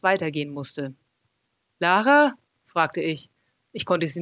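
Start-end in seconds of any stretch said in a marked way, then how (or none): 1.8-2.3 loud, high call into the pit. A narrator's reading. Narrator searches the dark for Lara, loud call.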